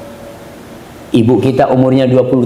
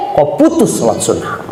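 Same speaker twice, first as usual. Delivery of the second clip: ordinary talk, nothing marked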